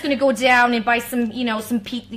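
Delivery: put-on Brooklyn accent, tossed off lightly. Singer showing off accents to her host, Brooklyn accent. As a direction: play a Brooklyn local. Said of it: "that's, like, the Brooklyn accent".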